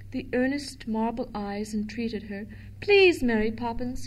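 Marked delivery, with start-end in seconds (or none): none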